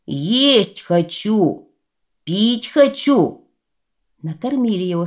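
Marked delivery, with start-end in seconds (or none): 0.1-3.5 slow, drawn-out demanding shout. Acted. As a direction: bellow it like a hungry giant. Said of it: "давай кричать".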